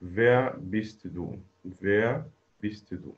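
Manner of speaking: very slow, each word spelled out clearly; slow model answer. Shown as a demonstration. Teacher models the right answer for students, very slowly. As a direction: read it slowly so students can copy every sound. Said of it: "هي wer".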